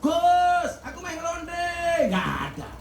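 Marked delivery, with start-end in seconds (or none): 0.0-1.6 high mock shout, pitched way up; hammed-up cry of a sinner calling to the cleric; mock yell. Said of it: "Orang mungkin dia teriak-teriak".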